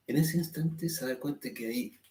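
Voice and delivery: ordinary talk, nothing marked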